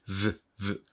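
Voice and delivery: "v" buzzed with lip on upper teeth; short, soft isolated "v" sounds; V sound demo. Shown as a demonstration. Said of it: "唇を軽く上の歯に当てて".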